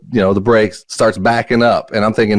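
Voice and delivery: ordinary talk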